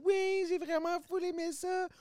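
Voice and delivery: put-on very high slow little kid; drawn-out child's happy answer.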